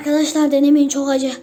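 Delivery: loud, burning-mouth warning; pained loud warning. Real, not acted. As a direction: cry out in real pain from the heat. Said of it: "Dilim yanıyor".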